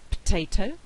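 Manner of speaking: "potato" as "ptato" with the first vowel lost; elided potato. Shown as a demonstration. Teacher demonstrating vowel elision. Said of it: "we get".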